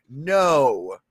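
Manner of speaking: usual speaking voice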